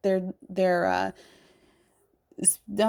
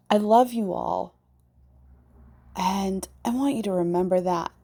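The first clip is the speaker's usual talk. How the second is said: normal talking voice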